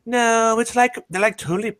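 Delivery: put-on high Valley Girl whine. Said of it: "I hear Valley Girl voice".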